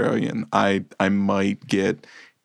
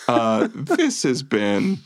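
ordinary talk, nothing marked

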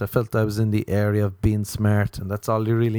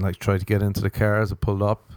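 ordinary talk, nothing marked

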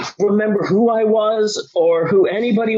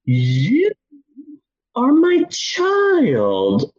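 mock solemn; 6 semitones higher, much slower than usual. Solemn dazed words to his daughter.

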